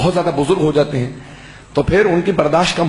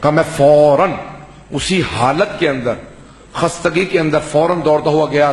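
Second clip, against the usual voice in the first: ordinary talk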